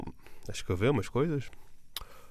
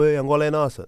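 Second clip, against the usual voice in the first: grita Angola é nossa mais alto, a gozar; grito a brincar; 5 semitones higher, 6 dB louder than usual. Retold or imitated. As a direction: berra como na farra com os amigos. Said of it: "e gritar". (grito de brincadeira entre amigos)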